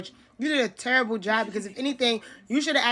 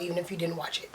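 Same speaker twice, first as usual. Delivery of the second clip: whispered aside; 5 semitones lower, 10 dB quieter, faster than usual. Real, not acted. Aside to viewers.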